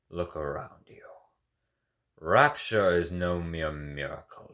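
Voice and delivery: ordinary talk, nothing marked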